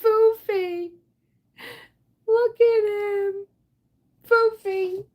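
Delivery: high, slow, tender cooing at the cat; cooing pet talk. Real, not acted. Cooing to her scared cat.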